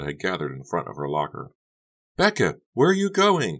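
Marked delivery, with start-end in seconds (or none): 2.2-3.6 high, loud call; calling after someone. A narrator's reading. Carrie to Becca rushing off, calling after her. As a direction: call after a friend who is leaving. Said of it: "Carrie called after her".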